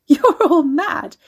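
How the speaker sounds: loud, high, laughing accusation; helpless, near-hysterical outburst; hysterical laughter. A narrator's reading. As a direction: laugh wildly through the words. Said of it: "She started to laugh".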